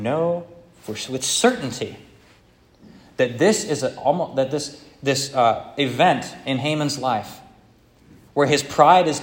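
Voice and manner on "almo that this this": ordinary talk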